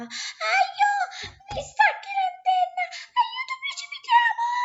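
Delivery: very high, loud screaming while falling; shrieking for help. Acted. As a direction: scream in panic as you fly through the air. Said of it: "mentre Mica strillava".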